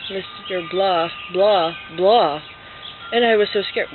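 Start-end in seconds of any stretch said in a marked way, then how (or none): 0.1-2.4 slow, unexpressive reading of blahs; reader's version. Shown as a demonstration. Reader who never heard the original, slow plain reading. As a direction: read the words slowly, without acting. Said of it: "Someone's gonna read the story".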